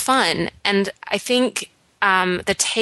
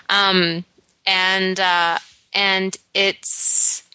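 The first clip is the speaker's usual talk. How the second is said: normal talking voice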